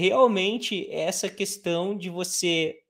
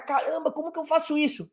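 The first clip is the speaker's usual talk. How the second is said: overwhelmed student; 8 semitones higher, faster than usual. Retold or imitated. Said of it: "Você vai ficar".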